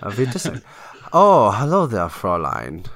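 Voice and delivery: a lightly put-on German accent, dropping low on Fräulein; low, hammy German charmer accent; mock German accent. Trying a German accent for friends, light mock German. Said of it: "think of a German accent".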